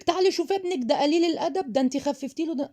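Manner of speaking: high-pitched nagging complaint; meddling critic. Acted. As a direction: play a fault-finding relative, pitched well up.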